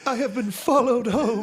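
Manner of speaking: high, loud, laughing mock voice; exaggerated high-pitched parody. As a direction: ham up a shrill indignant claim. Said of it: "I love how you play that".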